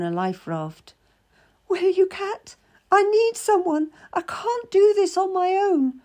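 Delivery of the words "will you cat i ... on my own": high, needy plea; clutching at a lifeline; pleading young lady. A narrator's reading. Frightened lady begs Cat to return, high pleading.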